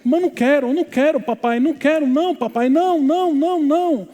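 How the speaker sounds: high, loud pleading that builds in pitch; imitated child's shouting. Pastor imitates boy pleading with father in the water, shouted protest. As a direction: act out a boy crying out in refusal to his father. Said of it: "e ele gritava".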